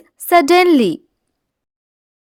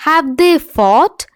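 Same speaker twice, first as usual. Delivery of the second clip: slow model reading; much slower than usual. Shown as a demonstration. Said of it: "teacher models the English sentence".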